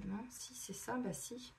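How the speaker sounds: very soft, near-whispered self-check; murmur to oneself. Real, not acted. Reader confirming a card to herself, hushed murmur. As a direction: think aloud under your breath.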